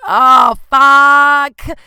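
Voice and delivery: mock-panicked yell of dismay; panicked shout. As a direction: blurt it out in sudden horror. Shocked reaction to chlamydia text.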